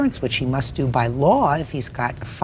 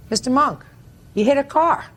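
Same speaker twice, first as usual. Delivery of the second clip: raised, sharp rebuke; stern scolding; 10 semitones higher, 4 dB louder than usual. Real, not acted. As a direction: scold him pointedly. Judge scolding the defendant.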